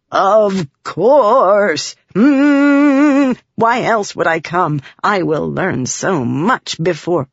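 loud, eager buzzing spren; a proud alien scholar. A narrator's reading. Pattern's voice.

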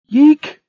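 very high, loud startled shriek; frightened squeal. Acted. Children grabbed in the dark forest, squealing in fright. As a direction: squeal like a scared baby squirrel. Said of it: "squealed aloud in fright".

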